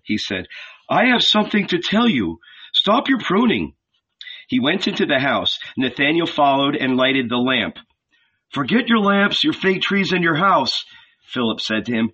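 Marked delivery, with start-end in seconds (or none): 0.9-3.7 higher, eager and pressing; urgent Philip. A narrator's reading. Philip urging Nathanael to stop pruning, eager. 8.5-10.8 higher, eager and pressing; urgent Philip. A narrator's reading. Philip urging Nathanael to stop pruning, eager.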